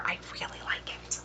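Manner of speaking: quick intimate whisper. Real, not acted. Telling viewers in a quick whisper she likes it. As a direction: confide it in a whisper.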